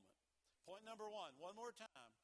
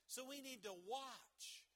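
normal talking voice

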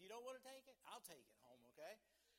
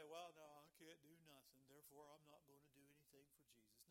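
low, soft, defeated mumble trailing away; small, deflated quitter voice; mock wimp; 5 semitones lower, 9 dB quieter than usual. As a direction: imitate a feeble wimp giving up. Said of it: "little weenies or wimps".

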